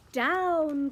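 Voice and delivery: loud high cry of down. Acted. Witch crying out to land the broom. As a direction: call it out, urgent. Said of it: "cried the witch".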